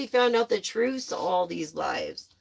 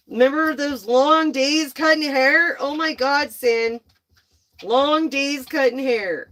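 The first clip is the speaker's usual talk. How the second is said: excited recall; 6 semitones higher, 6 dB louder than usual. Real, not acted. Excited reminiscing with viewer.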